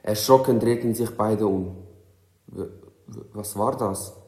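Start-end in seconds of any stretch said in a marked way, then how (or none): 2.5-4.1 softly stammered, mildly fearful question; anxious. A narrator's reading. Andrin startled by a cracking branch, anxious stammer. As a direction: stumble on the first word, a touch afraid. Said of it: "fragte Andrin ängstlich".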